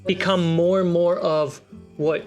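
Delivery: normal talking voice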